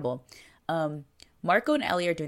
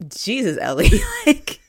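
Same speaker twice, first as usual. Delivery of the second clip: laughing disbelief; 11 semitones higher, 5 dB louder than usual. Real, not acted. Shocked reaction, laughing.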